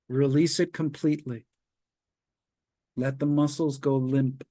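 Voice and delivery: calm, measured relaxation guide; walk them through tensing and releasing, unhurried; guided relaxation. Acted. Leads progressive muscle relaxation.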